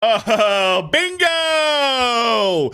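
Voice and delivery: high, loud burst of glee; triumphant bingo. Real, not acted. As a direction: shout it like you just caught her out. Gleeful catching her contradiction.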